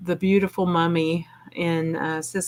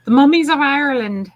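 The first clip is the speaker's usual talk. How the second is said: a hammed-up Irish brogue, pitched higher and louder; bad Irish accent; 10 semitones higher, 8 dB louder than usual. Joking on a paranormal radio show, a mock Irish accent. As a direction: toss off a deliberately terrible Irish accent. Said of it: "It was a really bad accent".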